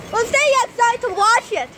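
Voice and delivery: ordinary delivery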